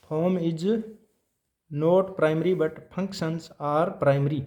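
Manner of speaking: very slow and deliberate, each word spaced; slow dictation. Shown as a demonstration. Teacher reading out a key point for notes, slowly. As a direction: dictate it so the class can note it down. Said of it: "teacher dictating a principle".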